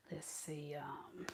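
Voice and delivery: unvoiced hush while dialing; secretive whisper at the phone. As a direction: whisper it to yourself.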